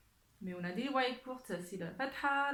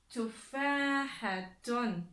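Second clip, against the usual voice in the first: very slow, syllable by syllable; lecture appuyée; much slower than usual. Shown as a demonstration. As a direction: stretch Tufahatun out for learners. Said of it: "on écoute bien la lettre". (lecture lente, syllabe par syllabe)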